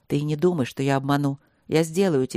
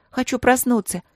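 mild, higher-pitched cry; 6 semitones higher, 3 dB louder than usual. A narrator's reading. Princess calls to the misty skies, mild cry. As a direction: raise the pitch and call out. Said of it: "крикнула она".

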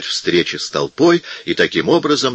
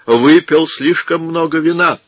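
higher, falsely humble monk voice; meek monk; 8 semitones higher than usual. A narrator's reading. Monk lies sweetly to the crowd about the drunk brother.

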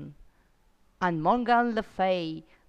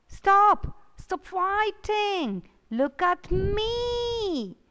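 shout; 9 semitones higher, slower than usual. Acted. Arthur shouts at the fighting Merlin and Morgan to stop. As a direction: cry out to break up the fight.

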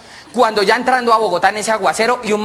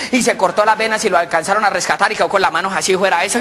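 ordinary delivery

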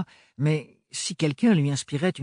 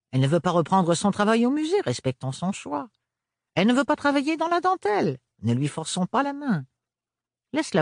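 a higher, prissy busybody lecturing the family; prim gossip; 10 semitones higher than usual. A narrator's reading. Rapporteuse lecturing the family, prim and high. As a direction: purse your lips and preach.

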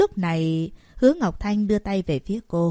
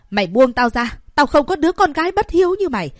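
much higher and louder, fast; hysterical scream; 7 semitones higher, 8 dB louder than usual. Acted. Mother struggling against her daughter, screaming hysterically.

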